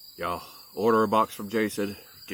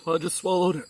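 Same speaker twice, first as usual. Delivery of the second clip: choking; 11 semitones higher, 15 dB louder than usual. Real, not acted. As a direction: voice strained from choking. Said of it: "Went down the wrong pipe".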